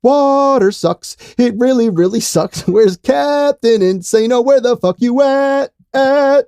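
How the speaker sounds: high, loud, slow heckling; Waterboy taunting. Acted. Podcaster quoting a football comedy, loud drawn-out taunt. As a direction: holler mocking taunts, stretched out. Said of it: "Speaking of water boy".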